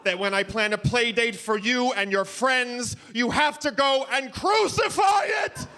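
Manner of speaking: high, theatrical scolding rising to a shout; Broadway choreographer yelling. Retold or imitated. Dad scolding son like a choreographer at the dance captain, shrill and theatrical. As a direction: scold like a diva choreographer after bad reviews. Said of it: "Broadway choreographer yelling at the dance captain".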